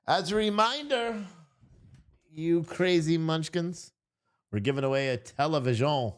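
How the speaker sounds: put-on playful French accent aimed at the livestream viewers. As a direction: announce the giveaway in a mock French accent. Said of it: "That's how you say it with a French accent".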